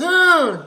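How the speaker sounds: imitated child's hum. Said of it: "and he goes".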